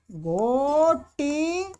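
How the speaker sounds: घोटिशिष्टा stretched out syllable by syllable; slow model form. Shown as a demonstration. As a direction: draw out each syllable of घोटिशिष्टा slowly. Slowly models new form.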